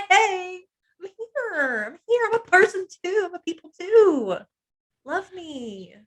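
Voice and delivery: high, loud, tearful plea; pleading to be seen. Retold or imitated. Speaker voicing an invisible woman, high tearful plea. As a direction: cry out to be noticed.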